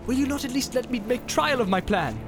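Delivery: high-pitched, pressing appeal; urgent protest climbing in pitch; insistent pleading. A narrator's reading. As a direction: plead your honesty, pushing for what you want. Boromir pleads for the ring.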